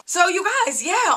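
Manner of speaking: ordinary talk, nothing marked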